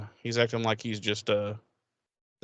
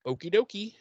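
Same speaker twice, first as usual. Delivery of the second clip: high, breezy okie dokie; a cheerful high sign-off; chirpy okie dokie; 9 semitones higher than usual. Retold or imitated. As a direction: toss it off lightly and brightly. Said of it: "Angel's like".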